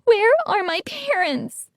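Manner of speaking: high, shaky plea; trembling voice. A narrator's reading. Son asking the robot mother, trembling high plea. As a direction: ask it as your voice breaks. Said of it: "my voice trembling".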